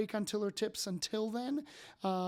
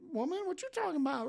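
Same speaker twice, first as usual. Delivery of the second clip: higher, fast, sassy disbelief; incredulous wife; 8 semitones higher, much faster than usual. Retold or imitated. Wife baffled by the gushing stranger, quick sassy disbelief. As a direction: fire it off, baffled and a bit sassy. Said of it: "And my wife's like".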